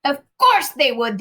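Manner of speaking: high, loud giant shout. A narrator's reading. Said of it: "the giant shouted".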